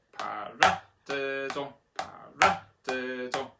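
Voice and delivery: "para, de, do" with RA punched; accent on second syllable. Shown as a demonstration. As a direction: pop the end of "para", soften "de, do". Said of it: "one, two, three, and four".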